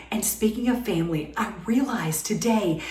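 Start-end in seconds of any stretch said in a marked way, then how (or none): none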